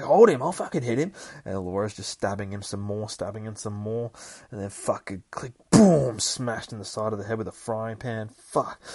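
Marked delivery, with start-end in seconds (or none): 0.0-1.1 high, loud battle yell; fierce shout. Retold or imitated. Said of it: "She's like". 5.7-6.2 loud boom; impact sound. Retold or imitated. Narrating impact.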